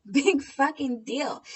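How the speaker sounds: loud, slow, high scoff; exasperated scornful big fucking deal. Real, not acted. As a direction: drag it out with contempt. Dismissing the confession.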